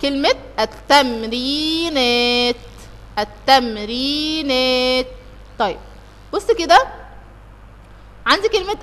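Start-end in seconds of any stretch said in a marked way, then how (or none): 0.0-5.1 drawn out. Shown as a demonstration. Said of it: "تعالى كده على الكلمة التانية".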